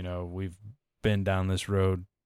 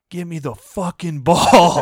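loud, high imitation of a player's angry yell; frustrated yell; 9 semitones higher, 13 dB louder than usual. Fed-up receiver caught yelling on camera, imitated frustrated yell. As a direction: yell it out slowly like a player demanding it. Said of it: "you can see him yell".

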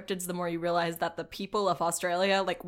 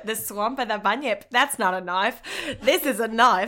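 hammed-up Aussie accent, getting louder; mock Australian accent; 2 semitones higher, 4 dB louder than usual. Host joking with co-host about the bunyip swamp, broad mock Australian accent. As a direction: build to a loud, laughing Aussie boast.

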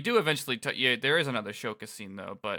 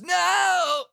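desperate shout; 6 dB louder than usual. Acted. Hero cries out in scene.

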